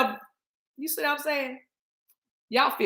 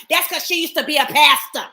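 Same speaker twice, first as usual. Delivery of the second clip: quoting chat; 2 semitones higher, 6 dB louder than usual. Retold or imitated. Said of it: "the tribe said something like".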